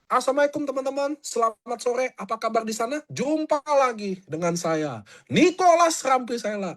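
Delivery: hammed-up polite self-introduction; overly polite intro. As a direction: play the over-courteous host. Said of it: "Langsung dibuang saya".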